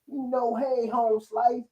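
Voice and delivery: high-pitched parody of outdated jive slang; mock someone putting on seventies jive; drawn-out, high mocking jive voice; mock jive talk. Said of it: "That's supposed to be a jive".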